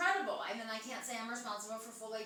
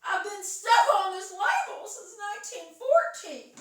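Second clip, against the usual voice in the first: loud, high put-on girl voice; hammed-up girlish protest; little girl's complaint; 7 semitones higher, 9 dB louder than usual. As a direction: wail it as the trapped label girl. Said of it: "And she says".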